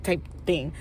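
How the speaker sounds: ordinary talk, nothing marked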